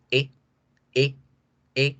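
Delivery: "E" kept very short, as in Spanish. Shown as a demonstration. Teacher demonstrating the short E. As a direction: clip the "E" short. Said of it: "No alargo el sonido".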